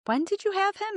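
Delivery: high-pitched, lightly voiced doctor's question; concerned doctor. New doctor asking a mother about her baby, concerned. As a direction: ask it high, imitating a doctor.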